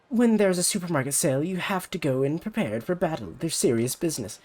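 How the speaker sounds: put-on high, pushy rival-girl voice; bratty rival idol. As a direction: talk down to her like a snobby idol. Ulala defends sales.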